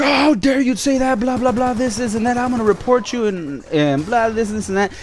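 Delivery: high-pitched indignant imitation of a complaint; put-on high voice, indignant blah-blah; mock upset. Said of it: "she got upset at that".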